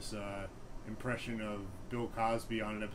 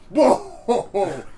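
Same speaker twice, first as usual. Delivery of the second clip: high, loud laughing whoa; classmate's amazed whoa; 10 semitones higher, 15 dB louder than usual. Retold or imitated. The classmate cracking up, high laughing whoa. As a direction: cry out through a laugh. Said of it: "he would be like".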